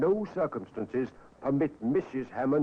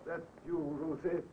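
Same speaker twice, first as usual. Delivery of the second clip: faint, feeble voice from a sickbed; barely able to call out; weak sick man; 8 dB quieter than usual. Acted. Dying husband calling his wife from the sickbed, faint and feeble.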